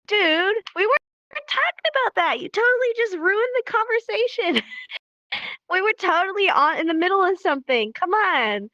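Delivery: high-pitched, hammed-up complaint; mock exasperation. Friend whose conversation was just ruined, mock exasperated and high. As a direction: whine it playfully at the person who interrupted. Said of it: "And you're like".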